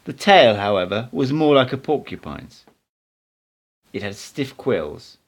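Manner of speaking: plain reading voice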